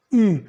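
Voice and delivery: U as a rounded "ü"; French U sound. Shown as a demonstration. Teacher showing U with umlaut, French U sound. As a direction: say U like the French vowel in menu. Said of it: "um sonzinho de U francês".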